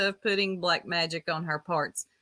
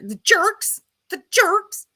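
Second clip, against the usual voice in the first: high mocking imitation, each jerks rising; imitating her; 11 semitones higher, 4 dB louder, slower than usual. Streamer mimics psychic's catchphrase, high mocking voice. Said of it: "that's how she says it".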